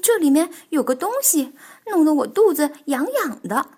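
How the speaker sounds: loud, high yelp of complaint; crying out. Acted. Bengbeng has something itchy in the costume, crying out. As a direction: call out in high-pitched discomfort. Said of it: "蹦蹦大叫".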